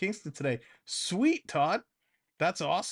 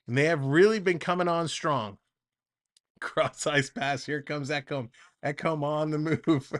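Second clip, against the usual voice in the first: play-by-play; 3 semitones higher than usual. Acted. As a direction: set the clock and score, then follow the puck. Play-by-play resumes.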